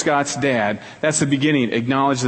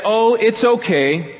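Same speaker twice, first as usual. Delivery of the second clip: brushing it off; 7 semitones higher, slower than usual. Retold or imitated. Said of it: "Don't say".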